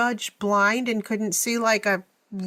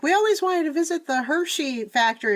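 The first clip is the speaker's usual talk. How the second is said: higher-pitched imitation of relatives; family's eager voice; 7 semitones higher than usual. Co-host imitating family wanting a trip, lightly voiced eagerness. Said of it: "My family will be like".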